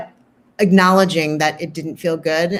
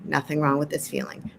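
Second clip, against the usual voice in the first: soft mantra; 3 semitones lower, 9 dB quieter than usual. Shown as a demonstration. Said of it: "a mantra that you might suggest".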